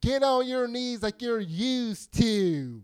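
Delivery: slow, loud sneering taunt; drawn out with contempt; menacing taunt. Retold or imitated. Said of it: "how do you say that with an implied".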